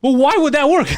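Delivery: high, loud disbelieving question; incredulous. Retold or imitated. Mocking the buddy's logic, high incredulous question. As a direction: ask in baffled disbelief.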